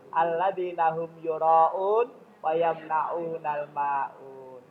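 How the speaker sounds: dilantunkan pelan seperti mengaji; tilawah ayat. Acted. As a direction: lantunkan ayat dengan khidmat dan lambat. Membaca ayat Al-Quran. (lantunan ayat yang pelan dan khusyuk)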